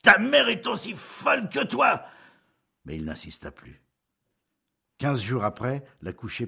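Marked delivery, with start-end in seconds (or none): 0.0-2.0 loud, raised furious yell; furious shout. A narrator's reading. Husband yelling at wife about her mother, furious. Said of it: "Julien, furieux, cria".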